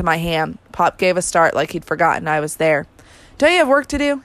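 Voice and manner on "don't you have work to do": a sharp snap pitched up high; a sudden irritated snap; snapped. A narrator's reading. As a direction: snap it at the boy who startled him. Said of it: "he snapped".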